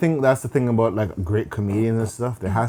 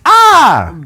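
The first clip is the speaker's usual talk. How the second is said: loud, high acted yell; sudden high outburst as an angry mom; mock scream; 12 dB louder than usual. Mom coming home to confront her kid, loud mock scream.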